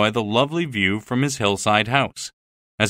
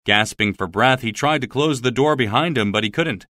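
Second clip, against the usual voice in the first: plain reading voice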